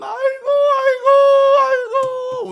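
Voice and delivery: high shouted aigo wails; mourning wail. Retold or imitated. Preacher voicing the weeping crowd, high shouted wails. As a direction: cry out like grieving mourners. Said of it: "울고 있는 그들을".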